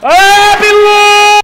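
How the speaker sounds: high, loud yell of fright; real shriek of shock; startled screamed curse. Real, not acted. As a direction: scream the swear word in alarm. Scare in horror game.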